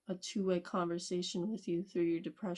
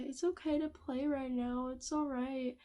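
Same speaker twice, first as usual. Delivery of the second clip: higher, soothing little-voice reassurance; a lightly voiced tempting inner voice; coaxing brain voice; 6 semitones higher than usual. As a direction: sweet-talk them into playing. Brain voiced reassuring about playing.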